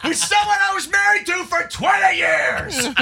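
full-out high shout, slowing at the end; yelling. Retold or imitated. Arguing about a twenty-year marriage, yelling. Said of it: "And that is yelling".

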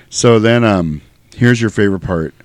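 ordinary talk, nothing marked

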